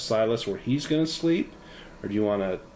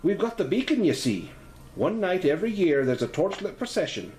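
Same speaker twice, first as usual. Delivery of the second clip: higher, proud Irish landlady; Irish lilt; 7 semitones higher than usual. Acted. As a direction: boast of the beacon in a light Irish lilt. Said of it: "Her voice has a slight Irish lilt".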